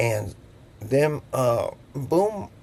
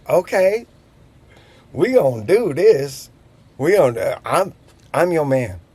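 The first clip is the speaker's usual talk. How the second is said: pumped up; 4 semitones higher, 4 dB louder than usual. Retold or imitated. Psyching himself up to help.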